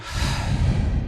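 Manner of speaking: long voiceless release of breath; open, airy sigh out; natural exhale sigh. Shown as a demonstration. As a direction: let the built-up breath go uncontrolled. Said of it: "just let that naturally exhale".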